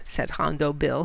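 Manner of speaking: usual speaking voice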